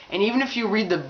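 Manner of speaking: ordinary talk, nothing marked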